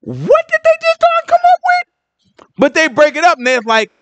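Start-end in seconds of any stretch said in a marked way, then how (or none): 0.0-1.7 mock incredulous voice, much higher; baffled reader. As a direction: squeak it out in disbelief. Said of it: "I was reading it like".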